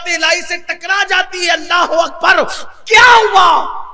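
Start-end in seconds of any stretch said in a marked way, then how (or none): 2.8-3.8 a slow, booming "کیا ہوا؟" with کیا hit hard; shouted question. Acted. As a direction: roar the question to the crowd. Preacher repeats the question. ("کیا ہوا؟" زور سے، آہستہ اور بلند آواز میں)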